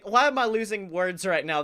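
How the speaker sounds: ordinary talk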